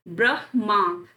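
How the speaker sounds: ब्रह्मा slowly, brah... maa; correct pronunciation. Shown as a demonstration. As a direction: spell out ब्रह्मा with h first. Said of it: "माँ बाद में". (ब्रह्मा धीरे, म बाद में)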